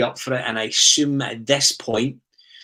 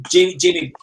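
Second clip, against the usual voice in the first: raised, higher call to get his attention; louder and higher, calling on a co-host; 8 semitones higher, 9 dB louder than usual. Real, not acted. Host calls on panelist by name.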